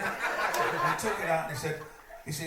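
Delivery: high and loud through laughter; laughing. Real, not acted. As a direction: narrate while laughing.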